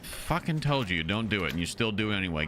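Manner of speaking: ordinary talk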